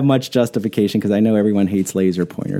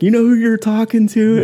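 playful threat, pitched up; mock challenge; 10 semitones higher than usual. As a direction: warn the guy off. Ben's line repeated.

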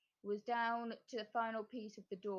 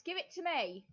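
demanding; 6 semitones higher than usual. A narrator's reading. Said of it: "demanded the cat".

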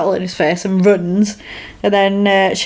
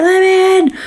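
high, aggressive retold cry; aggressive demand; 11 semitones higher, 3 dB louder than usual. Woman at the window demanding entry, mock aggressive. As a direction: demand entry, pitch jumping up. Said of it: "she gets really aggressive".

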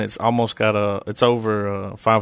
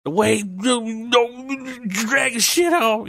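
much higher and slow comic scolding; Tracy Morgan voice; 12 semitones higher, much slower than usual. Pretend. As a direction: drag it out in a squeaky mock scold. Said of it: "I want my phone to be like".

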